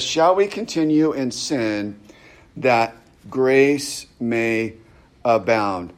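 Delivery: slow, spaced-out verse reading; deliberate reading. Retold or imitated. Preacher quoting Paul's question for close study, deliberate. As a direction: space out "grace may abound" word by word.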